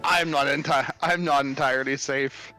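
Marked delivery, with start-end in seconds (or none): none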